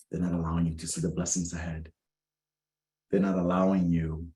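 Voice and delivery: ordinary talk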